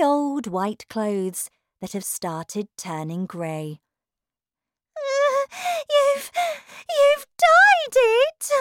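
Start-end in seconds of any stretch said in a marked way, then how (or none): 5.0-8.5 very high, loud anguished cry. Acted. Wife crying out at her husband, anguished. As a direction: cry it out, stammering. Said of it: "cried Missus Wormwood".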